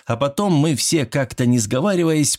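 plain reading voice

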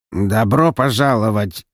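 slow, grating mechanical voice; a creaky old android grinding it out; grating robot voice. Acted. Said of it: "проскрежетал он механическим голосом".

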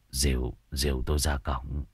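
low, soft, trembling plea; weak old healer. A narrator's reading. Exhausted healer asking to be led to the gate, weak and soft. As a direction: barely get the words out. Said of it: "Thầy run run".

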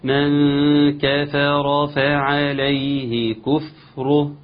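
slow full Quranic recitation; verse recitation. Shown as a demonstration. Teacher reciting the full verse after the lesson. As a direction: recite the whole verse carefully. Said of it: "نقرأ الآية".

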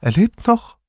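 can't believe what you see; disbelieving. A narrator's reading. Grandma sees her son-in-law alive, stunned disbelief. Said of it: "murmelte sie ungläubig".

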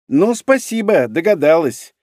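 sarcastic. A narrator's reading. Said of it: "едко подтвердил".

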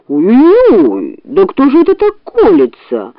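yelping bear in pain; howl, then complain high-pitched; pained bear outcry; roaring bear. A narrator's reading. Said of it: "взревел медведь".

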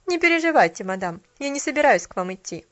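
loud, cold retort; cold disapproval. A narrator's reading. Shopgirl answering Mrs Carew, cold. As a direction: answer coldly. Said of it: "холодно и с ещё большим неодобрением".

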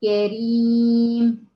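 كريم drawn out very slowly, the ra kept light; slow stretched كريم; tajweed demo word. Shown as a demonstration. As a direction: model كريم slowly with a thin ra. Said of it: "مثلًا".

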